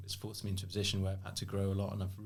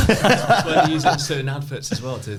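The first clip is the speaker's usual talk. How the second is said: louder, higher, breaking into laughs; laughing; 7 semitones higher, 13 dB louder than usual. Real, not acted. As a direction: laugh through it. Laughing while talking.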